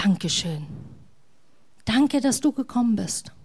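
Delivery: softly whispered thanks, barely above breath; gentle whisper. Acted. God thanking the listener for coming, in a gentle whisper. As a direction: whisper it like a quiet secret of gratitude. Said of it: "Gott zu dir flüstert".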